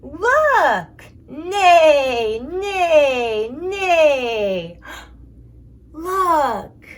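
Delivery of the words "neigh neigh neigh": big whinnying neigh, loud and crying out; loud horse neigh. Pretend. Mom shows child a horse, loud whinnying neigh. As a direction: call it out like an excited horse.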